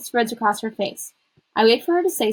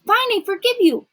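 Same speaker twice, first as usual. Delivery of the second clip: high, louder, fed-up giving in; exasperated concession; 13 semitones higher, 5 dB louder than usual. A narrator's reading. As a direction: forgive just to end the nagging. Grudging answer in quarrel.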